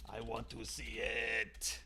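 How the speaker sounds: slow, drawn-out low creepy voice; creep's tagline. Acted. Trying out his tagline, slow and creepy. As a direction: stretch it out, low and soft, as a mock creep. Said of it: "maybe his tagline should be".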